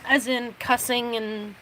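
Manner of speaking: ordinary talk, nothing marked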